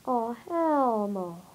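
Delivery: ordinary talk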